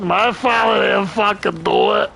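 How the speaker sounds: mock the daughter's New York Italian whine; whiny New York Italian accent. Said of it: "whiny fucking Italian fucking girl accent".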